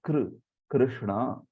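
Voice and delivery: ordinary talk, nothing marked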